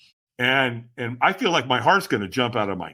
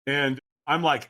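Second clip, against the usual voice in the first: ordinary talk, nothing marked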